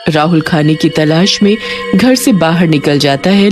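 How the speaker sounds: plain reading voice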